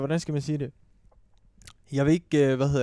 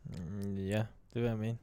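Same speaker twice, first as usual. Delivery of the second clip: soft, low, hesitant agreement; tentative yes after a drawn-out "mm"; real hesitancy; 7 semitones lower, 14 dB quieter, slower than usual. Real, not acted. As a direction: agree reluctantly, much quieter and lower. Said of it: "der var meget tøvende".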